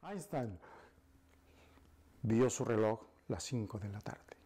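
usual speaking voice

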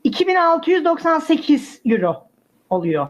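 ordinary talk, nothing marked

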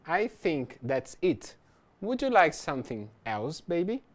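slow, careful read of a sample line; slow model reading. Shown as a demonstration. Teacher reading a dialogue line to learners, slow and careful. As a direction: read it slowly for students to follow. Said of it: "Ele diz o seguinte".

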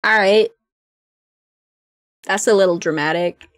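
ordinary talk

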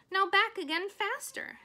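loud high urging; coaching shout. Acted. Charlotte urges Wilbur on, loud coaching call. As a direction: push him harder. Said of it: "said Charlotte".

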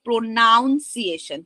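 "pronunciation" said with NOUN in the middle; wrong "pronunciation". Shown as a demonstration. Teacher showing a non-existent word, "pronunciation" with "noun" in it. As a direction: slowly say "pronunciation" as pro-noun-ciation. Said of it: "there isn't any word like".

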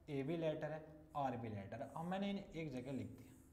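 ordinary talk

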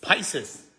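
Pisces said the English way, "PIE-seez". Shown as a demonstration. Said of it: "En inglés se dice Pisces". (Pisces a la inglesa, "páisis")

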